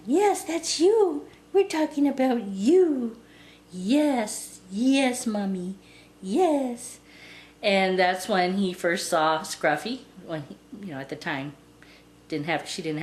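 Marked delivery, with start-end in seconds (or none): 0.1-7.0 high, drawn-out cooing to a little dog; real sing-song pet talk. Real, not acted. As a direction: gush sweetly in a high doting voice. Cooing to her dog mid-story.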